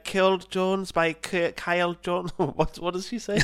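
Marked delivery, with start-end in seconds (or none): 0.1-2.3 high-pitched Scottish singer introducing himself; mock Scottish audition patter; Keir Hardie. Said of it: "Hey, I'm Keir Hardie".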